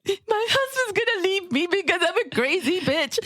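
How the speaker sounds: high-pitched, blubbering wail; mock crying. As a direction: retell it as a hammed-up sobbing lament. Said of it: "and I was crying".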